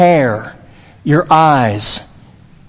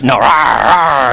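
mock bear growl; 6 semitones lower, slower than usual. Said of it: "he would just".

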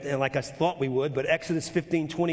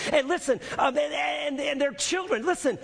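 preaching; 9 semitones higher than usual. Real, not acted.

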